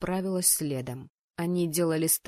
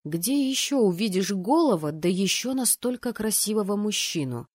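ordinary delivery

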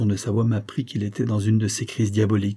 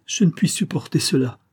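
higher, distraught exclamation; exclaiming; 6 semitones higher than usual. A narrator's reading. Edith begs the doctor, exclaiming higher and distraught. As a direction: she bursts out, pitch up, at her limit. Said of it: "s'exclama-t-elle".

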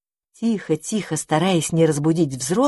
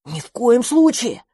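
frightened; 5 semitones higher than usual. Acted. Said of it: "испугался мышевраг".

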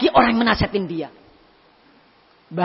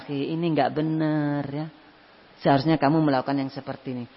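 gentle advice; 7 semitones lower, 6 dB quieter than usual. Retold or imitated. Friend gently advising a brother.

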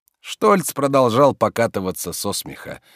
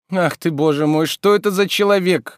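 high, raised complaint; exasperated; 9 semitones higher, 4 dB louder than usual. A narrator's reading. Master despairing of his servant, high exasperation. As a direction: wail mildly at his persistence.

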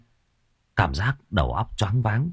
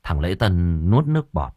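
usual speaking voice

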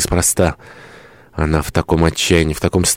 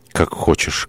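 subdued first take; 4 dB quieter, slower than usual. Acted. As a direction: give in almost under the breath. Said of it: "воскликнул он".

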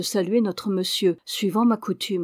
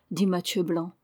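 usual speaking voice

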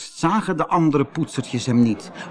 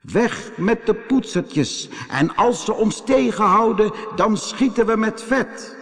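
war cry; 7 semitones higher than usual. Acted. Smear-brushes threaten the poetsertjes, high gloating chant. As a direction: chant it high, like a gang setting off to attack.

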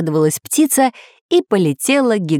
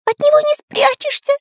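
very high, squeaky despair; tiny high-pitched tearful voice; nearly crying. A narrator's reading. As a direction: squeal it as if about to cry. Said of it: "чуть не плакал Карандаш".

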